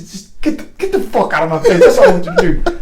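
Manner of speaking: building to a high, loud outburst; mock-exasperated order that climbs to a peak; imitated angry dismissal. Sergeant major throwing a soldier out, exasperated and imitated.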